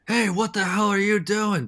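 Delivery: loud, high, startled yell from below; shouted challenge. Retold or imitated. Man in the basement yelling at the intruders, loud high shout. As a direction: bark it out like a man caught off guard. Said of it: "all you hear is".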